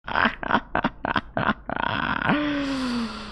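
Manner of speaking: long breathless high laugh; laughing fit. Real, not acted. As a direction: dissolve into helpless laughter.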